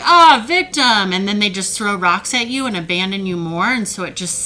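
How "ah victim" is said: loud, slow, higher mocking jeer of victim; mocking sneer. Narcissist jeering at someone who speaks up, loud mock sneer. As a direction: sneer it out at the complainer. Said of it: "they're like".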